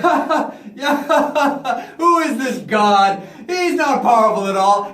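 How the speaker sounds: big, loud scornful laugh into words; mocking laughter. Pharaoh laughs at Moses' God, loud mocking laughter. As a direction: laugh the rival god off loudly. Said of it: "He laughed at him".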